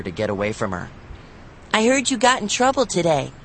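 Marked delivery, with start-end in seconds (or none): 1.7-3.3 higher, a little sister's teasing call. A narrator's reading. Livy teasing her brother, calling out. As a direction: call it after him teasingly. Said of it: "she called".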